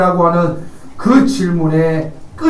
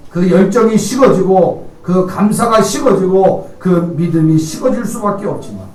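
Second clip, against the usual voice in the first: ordinary delivery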